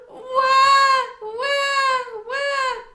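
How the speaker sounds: put-on high, loud baby wail; baby crying. Baby brother starts crying loudly in the next room. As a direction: bawl like a baby.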